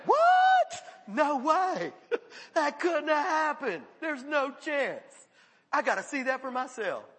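high and loud, gasping with disbelief; a high-pitched mock gasp of disbelief; shocked disbelief. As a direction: act stunned that someone got saved. Voicing shocked doubters.

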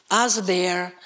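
usual speaking voice

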